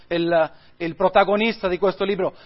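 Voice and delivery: ordinary talk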